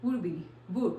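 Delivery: "would" with the d stopped, not released; soft stopped d. Shown as a demonstration. Teacher shows how native speakers soften the d. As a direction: hold the d in "would" without a release. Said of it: "तो कैसे कहते हैं".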